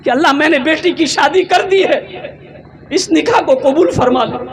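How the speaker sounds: preacher voicing a crying father's prayer; tearful prayer. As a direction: plead as if in tears. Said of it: "जमीन पर बैठा रो रहा था".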